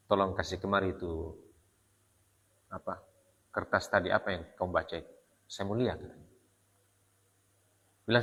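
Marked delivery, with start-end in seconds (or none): 0.1-6.0 softer, calmed-down request; lowered voice. Retold or imitated. Umar calmly asks his sister for the pages, softer. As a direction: ask gently now that the anger has passed. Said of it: "suaranya sudah mulai turun".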